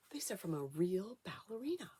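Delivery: hushed whisper over the real shoes; soft wondering whisper. Acted. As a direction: whisper it like a treasure. Showing precious ballerina shoes.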